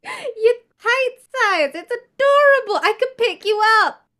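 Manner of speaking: put-on high, squealing baby talk; doting coo. Character squealing at a tiny pup, high doting baby talk. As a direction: squeal over how tiny he is.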